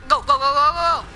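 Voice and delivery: loud urgent go go go; urgent shouting. Real, not acted. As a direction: push them on with a shout. Urging teammate on.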